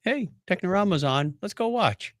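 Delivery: ordinary talk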